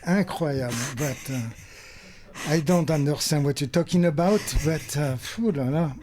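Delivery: mock French accent, lightly put on; playful fake French accent; thick French accent. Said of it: "thick French accent that I know he doesn't actually possess".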